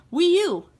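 high, loud gasp of delight; gasp, then burst out with it; excited idea. Acted. Bored player suddenly thinks of the Wii U, excited.

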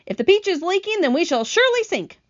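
high-pitched lament; alarmed cry. A narrator's reading. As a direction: cry out that doom is coming. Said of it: "cried the earthworm".

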